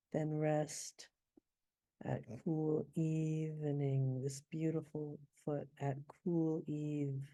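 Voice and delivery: slow, savoring poetry read; poem recital. Shown as a demonstration. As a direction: linger on each word of the verse.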